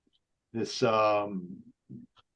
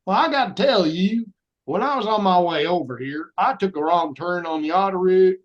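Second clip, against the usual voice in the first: put-on Southern accent; 6 semitones higher, 3 dB louder than usual. Auditioning for French-speaking casting directors in Southern accent. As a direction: spin the story in a thick Southern accent. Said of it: "gave them my best Southern accent".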